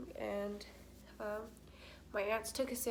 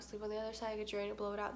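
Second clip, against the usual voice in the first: usual speaking voice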